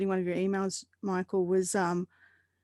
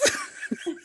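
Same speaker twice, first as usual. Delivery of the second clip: embarrassed squeal; 3 dB quieter than usual. Real, not acted. After naming her odd phobia.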